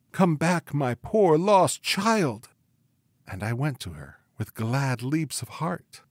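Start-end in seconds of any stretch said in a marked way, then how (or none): 0.1-2.5 weeping mother's cry. A narrator's reading. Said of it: "she wept and cried".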